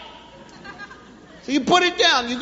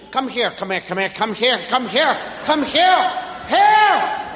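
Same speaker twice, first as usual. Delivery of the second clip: escalating barrage; 2 semitones lower than usual. Shown as a demonstration. Parent calling a child who doesn't hear, escalating barrage. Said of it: "send a barrage of hears".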